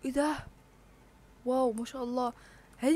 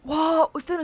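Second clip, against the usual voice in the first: excited wow; 5 semitones higher, 7 dB louder than usual. Real, not acted. Rare pet appears.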